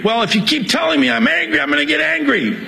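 half-joking rise into high mock anger; mock angry. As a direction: pretend to flare up, tongue in cheek.

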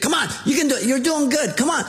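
high cheering coaxing; encouraging parent. Retold or imitated. Parent urging a discouraged child at his game, high cheering. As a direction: cheer on a child who keeps missing.

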